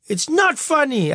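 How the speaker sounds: put-on boy protesting to his laughing mother; hurt little boy. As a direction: plead like an offended child. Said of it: "I said".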